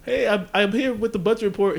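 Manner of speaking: high-pitched imitation of a second grader; little kid. Little Vince at the teachers' lounge door, imitated kid voice. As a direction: play an adorable small boy, voice up high. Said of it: "little li-, Vince".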